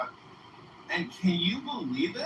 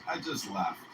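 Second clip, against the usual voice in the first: usual speaking voice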